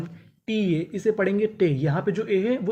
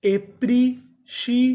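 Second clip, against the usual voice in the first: एप्रिशिएटर stretched slowly, higher and louder; slow drawn-out model word; 5 semitones higher, 4 dB louder, much slower than usual. Shown as a demonstration. Teacher sounds out एप्रिशिएटर slowly for the class. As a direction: spell out एप्रिशिएटर in slow syllables. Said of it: "तो पूरी meaning क्या बनी?".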